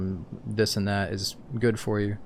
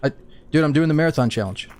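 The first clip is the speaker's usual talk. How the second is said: high, loud, rushed explaining; frantic pleading; 7 semitones higher, 8 dB louder than usual. Real, not acted. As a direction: plead fast so they hold fire. Explaining himself to armed players.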